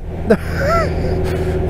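short, high real laugh; amused laughter. Real, not acted. Storyteller after reminding his friend, high burst of laughter.

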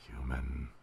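creepy character voice. Acted. Mysterious figure to the human, creepy and low. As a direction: drop it low and soft, creepily. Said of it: "I'm gonna do that voice".

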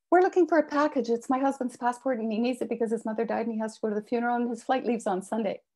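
desperate rapid explanation; talking fast. Acted. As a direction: get it all out before he leaves. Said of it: "I was talking fast".

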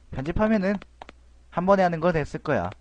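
usual speaking voice

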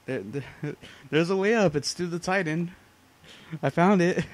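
loud, high triumphant discovery; thrilled to find it; excited. Real, not acted.

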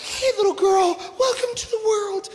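high, tender coo to a newborn; new mother cooing. Retold or imitated. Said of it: "She's like".

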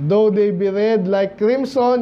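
measured, solemn verse read slowly; slow solemn Bible recitation; slow scripture reading. Acted.

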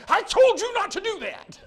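high, very loud scolding yell; angry parent. Acted. Father scolding his little girl for running into the road, angry yell. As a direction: yell it like a frightened, furious dad. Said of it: "She's gonna think I'm angry".

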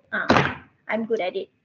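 normal talking voice

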